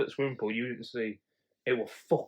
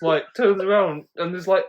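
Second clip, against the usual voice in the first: ordinary talk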